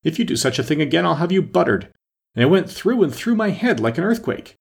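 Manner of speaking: high-pitched scolding from a shaken king; worked-up, complaining king; indignant crying out. A narrator's reading. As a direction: protest with the pitch pushed up. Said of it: "cried the poor king, jumping up and shaking himself".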